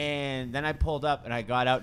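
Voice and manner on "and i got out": usual speaking voice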